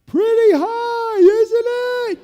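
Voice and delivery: slow, high, loud drawn-out taunt; mock teasing sergeant. Sergeant taunting nervous lieutenant at the door. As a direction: needle him loudly.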